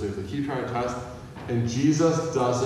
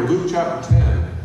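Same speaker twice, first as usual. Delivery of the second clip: slow and loud, calling out the reference; loud announcement; 5 semitones higher, 8 dB louder, slower than usual. Real, not acted. Announcing the scripture passage.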